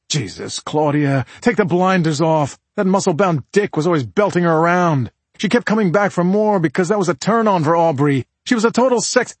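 high, forceful rant, climbing as it goes; heated, exasperated tirade; exasperated husband. Acted. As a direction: lay into her, building in pitch. Husband rants at his wife.

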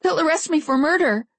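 high, panicky voice; half-hysterical. A narrator's reading. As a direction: panic rising, pitched high. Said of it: "I gave a half-hysterical hiccup".

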